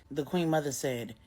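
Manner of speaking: usual speaking voice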